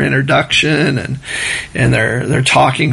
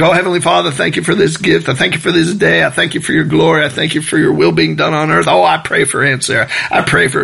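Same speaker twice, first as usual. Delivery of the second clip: rattled-off prayer, a touch fast; mock speed prayer; 2 semitones higher, faster than usual. Preacher mocking a rushed prayer, mildly fast. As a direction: rush through the prayer like a hammed-up speed talker. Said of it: "it's like speed prayer".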